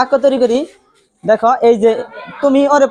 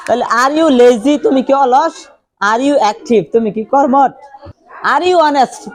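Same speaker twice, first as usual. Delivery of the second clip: ordinary talk, nothing marked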